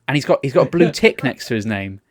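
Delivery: ordinary delivery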